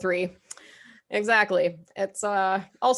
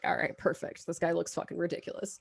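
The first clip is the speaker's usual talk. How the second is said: quiet aside; 3 semitones lower, 7 dB quieter than usual. Retold or imitated. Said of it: "I was like".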